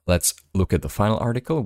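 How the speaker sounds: normal talking voice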